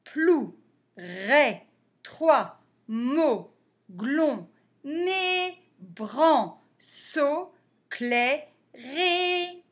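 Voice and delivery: short separate syllables at a steady beat. Shown as a demonstration. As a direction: say each syllable briskly, without stretching. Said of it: "on va plus vite".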